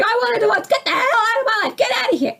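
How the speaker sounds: high, loud enraged shouting; screaming in a fight. Retold or imitated. Spouse ordering partner out, high furious shout. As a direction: throw them out of your life at full pitch. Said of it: "when you're screaming at your husband".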